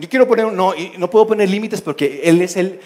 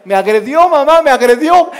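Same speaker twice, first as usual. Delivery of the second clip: put-on yelling child; 8 semitones higher, 9 dB louder than usual. Child accuses sibling to mother over a light touch, shouting. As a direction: overblown loud tattling, rising high. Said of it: "se andaban gritando, peleando".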